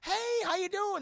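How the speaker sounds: high-voiced ex-girlfriend saying hi; girlfriend's greeting. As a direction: play the cheerful ex bumping into him. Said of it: "She goes".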